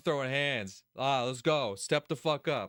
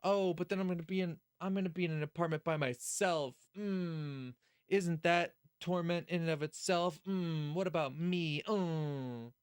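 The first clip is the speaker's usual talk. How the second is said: mock whining; 3 semitones higher than usual. Streamer mimics a character's self-pitying thoughts, mock whining. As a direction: moan it in mock self-pity. Mocking a whiny inner monologue.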